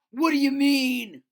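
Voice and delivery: loud, high, cutting in; sharp demand. Acted. Tyrone cutting in on the grumbling Swampies, loud and sharp. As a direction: cut in hard, demanding an answer.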